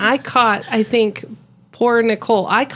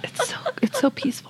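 whisper; 14 semitones higher, 10 dB quieter than usual. Pretend. Mock calm whisper.

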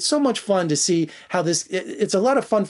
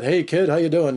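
put-on casual tough-guy greeting to a kid; lightly put-on older guy calling him kid; breezy wise-guy hello; streetwise mentor voice. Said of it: "kinda like that".

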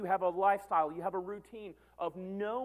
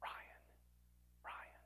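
whispered name, much softer; 18 dB quieter than usual. Acted. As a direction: barely breathe the name out, like a distant call. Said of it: "If they said it that softly".

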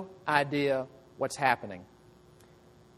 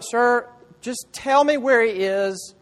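higher, louder and slow, a desperate plea; pleading Mary; 6 semitones higher, 6 dB louder than usual. Acted. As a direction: beg the gardener to tell you where he is. Mary pleads at the empty tomb.

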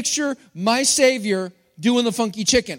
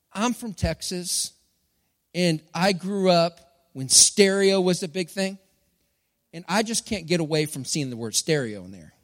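ordinary talk

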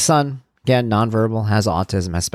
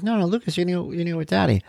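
higher, quick redirecting voice; teacher coaxing child; 9 semitones higher, 3 dB quieter, faster than usual. Retold or imitated. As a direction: lightly imitate a teacher steering a little kid.

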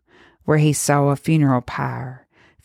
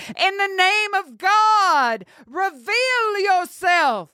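high, slow, full-lunged cry to the unseen; desperate shout; slower than usual. A narrator's reading. Hunter shouting into the smoke at unseen creatures, full-voiced shout. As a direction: bellow it out, terrified and defiant. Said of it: "shouting at the top of his lungs".

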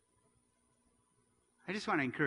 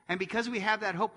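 ordinary talk, nothing marked

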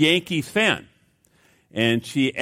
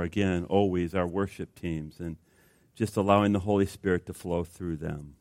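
soft, low, intimate prayer; 8 semitones lower, 5 dB quieter than usual. Acted. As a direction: pray quietly and earnestly before the congregation. Pastor prays before the sermon.

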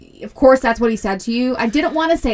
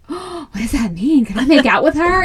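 put-on a higher, eager kid voice; eager boy; 4 semitones higher, 3 dB louder than usual. Son asks his mom about kissing, eager and higher. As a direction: play the excited little boy asking. Son's eager question retold.

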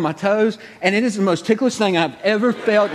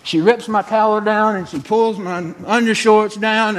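normal talking voice

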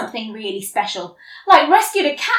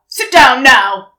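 loud, slow command; bossy order; 11 dB louder than usual. Acted. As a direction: order him to sit, firmly. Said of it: "my brother walked in".

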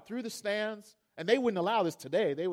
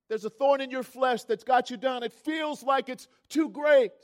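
loud, raised sermon voice; impassioned preacher, higher and forceful; fervent preaching; 4 semitones higher, 7 dB louder than usual. Real, not acted. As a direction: drive the lesson home to the congregation. Preaches the lesson of the story.

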